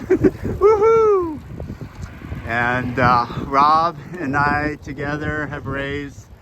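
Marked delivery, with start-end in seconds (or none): 0.1-1.4 high, loud celebratory woohoo; joyful whoop. Real, not acted. Rider arriving home after the long ride, whooping. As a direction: cheer it out at the finish.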